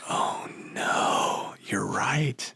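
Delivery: soft whispered dread; whispered realization. Retold or imitated. Admitting his buddy is right, hushed whisper of dawning dread. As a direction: whisper it like you just got caught out.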